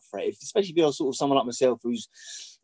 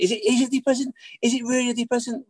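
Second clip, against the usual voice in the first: high, mock-incredulous voice of the party guy; mocking the drunk guy; 10 semitones higher than usual. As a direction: ape the man's disbelief, pitched up. Mimicking the man at party.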